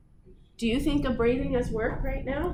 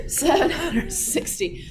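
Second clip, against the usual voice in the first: laughing; 7 semitones higher, 4 dB louder than usual. Real, not acted. Laughing restart of the lesson.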